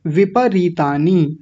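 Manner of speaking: "विपरीतानी" slow, full pa and long री; full vowel. Shown as a demonstration. Teacher modelling for students, full प in "विपरीतानी". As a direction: say "विपरीतानी" as vi-pa-ree-taa-ni. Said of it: "प को पूरा बोलना है".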